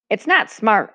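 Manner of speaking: ordinary delivery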